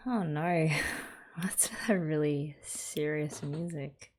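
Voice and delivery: ordinary talk, nothing marked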